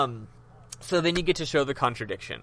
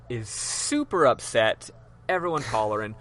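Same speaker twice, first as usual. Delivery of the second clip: usual speaking voice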